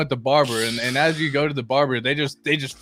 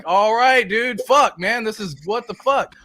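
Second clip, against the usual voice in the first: exasperated protest; 7 semitones higher, 3 dB louder, slower than usual. Retold or imitated. Acting out his protest to barber.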